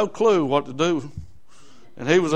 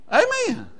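high amen. Real, not acted. Preacher's high exclamation.

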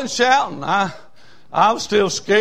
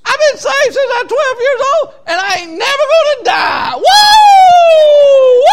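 high, loud crying holler; old lady shouting she will never die; old lady's shout; 14 dB louder than usual. Retold or imitated. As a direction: testify at full force with a sob. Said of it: "And she said".

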